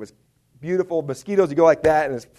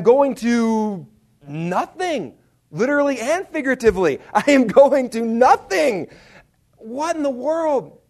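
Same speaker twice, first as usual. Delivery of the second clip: slow, high, drawn-out indignation; mad, exasperated; 8 semitones higher, 5 dB louder, slower than usual. Retold or imitated. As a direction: stretch out each word in mock outrage. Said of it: "And I'm mad".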